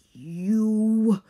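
strangled furious cry; choked rage. A narrator's reading. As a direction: choke on the word. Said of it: "He was breathing hard".

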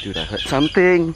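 sudden high, loud alarm; startled alarm. Real, not acted. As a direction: jump at a noise. Startled by a noise.

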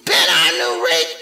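high, fussy scolding; scolding fish voice. A narrator's reading. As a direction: tell the cat off like a fretful fish. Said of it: "said the fish in the pot".

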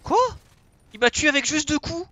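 high, loud indignant question; shocked disbelief at dying; outraged surprise. Real, not acted. Killed suddenly in game. (surprise indignée)

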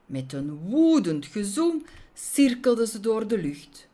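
ordinary delivery

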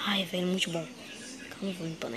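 relieved whisper. Acted. Relief, drinking water.

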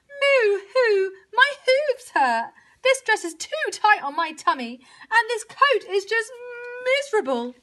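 high, whiny wail; high tearful complaining; crying cow. A narrator's reading. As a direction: moan it as a miserable cow. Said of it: "cried Cow from the back".

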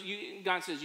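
ordinary talk

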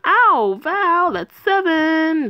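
little sister complaining to Val after a slap; pained kid whine. Acted. Sister slapped in slap game.

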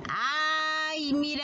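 ordinary talk, nothing marked

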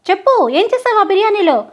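acted impatient demand; angry demand. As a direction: press sharply for the confession. Father demands the confession.

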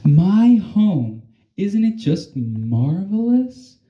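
a hint of a dreamy sigh through it; mild happy sigh. Beast showing Alma her bald home, mildly dreamy. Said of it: "sighed the beast".

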